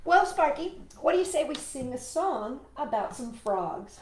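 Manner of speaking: usual speaking voice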